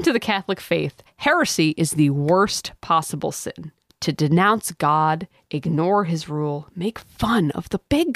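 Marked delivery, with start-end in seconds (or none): none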